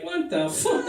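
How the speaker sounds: high startled outburst; shocked teacher. Retold or imitated. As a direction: react as if caught off guard. Teacher sees Fabio cover.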